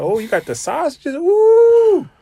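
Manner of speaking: high, excited gasp at the sausages; delighted guest. Retold or imitated. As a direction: squeal it high and loud, thrilled. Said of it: "And he's like".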